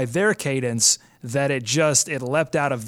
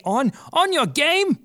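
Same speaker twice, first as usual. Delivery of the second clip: higher-pitched mock English accent; accidental English accent; 13 semitones higher than usual. Host slipping into accent talking about the English, mock English accent. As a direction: slip into a put-on English accent, pitch leaping up. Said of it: "See, I just did it again".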